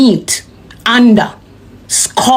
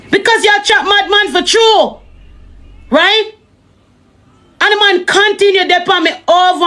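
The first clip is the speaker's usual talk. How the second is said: high pitched exasperated rant; 8 semitones higher than usual. Real, not acted.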